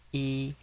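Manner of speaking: yi high and level; first tone. Shown as a demonstration. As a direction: hold "yi" flat up high. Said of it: "the first tone is".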